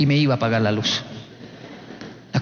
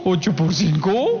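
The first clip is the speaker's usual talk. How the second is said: put-on puzzled child; slower than usual. Child slowly repeating, lost.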